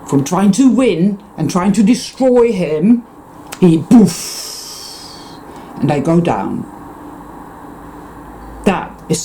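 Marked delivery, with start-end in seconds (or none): 3.8-5.4 a breathy voiceless puff for the knockout; poof sound. Retold or imitated. Picturing Muhammad Ali's knockout with a puff. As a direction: blow the word out like a burst.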